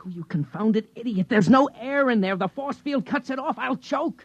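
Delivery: high, rising outrage; exasperated panic. Acted. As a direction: shout down the fool. Panicked rage at Sliggert.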